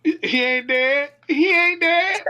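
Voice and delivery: excited. Real, not acted. Laughing at sleepy friend.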